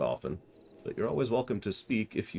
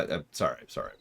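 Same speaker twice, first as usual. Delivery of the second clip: ordinary delivery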